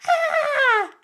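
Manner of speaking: high, sudden mock shriek of a frozen zombie-movie victim; panicked scream. A zombie-movie victim shrieks in mock panic, high and sudden. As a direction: let out a short, very high scream of helpless panic. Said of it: "the people in the zombie movie who are like".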